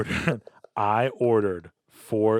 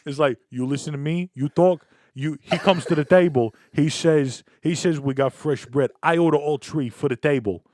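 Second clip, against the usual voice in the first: louder, higher mock Italian-American father; Italian dad; 5 semitones higher, 5 dB louder than usual. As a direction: scold the date like an old-school Italian dad. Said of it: "is this how Italian men".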